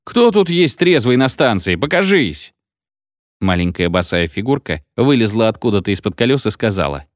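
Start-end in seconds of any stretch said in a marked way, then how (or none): 0.0-2.5 high-pitched searching call; raised hailing voice. A narrator's reading. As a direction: call out for someone to appear. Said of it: "кричал обер и свистел".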